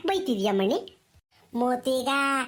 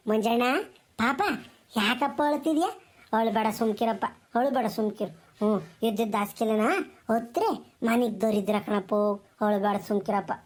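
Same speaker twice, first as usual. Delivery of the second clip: low-pitched consoling voice; soothing; 8 semitones lower than usual. Acted. Grandmother calms scolded grandson, low soothing tone. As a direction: hush a crying child gently, keeping the pitch low.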